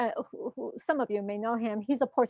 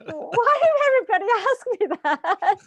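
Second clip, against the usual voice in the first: laughing disbelief; 8 semitones higher, 6 dB louder than usual. Retold or imitated. Retold bafflement, laughing.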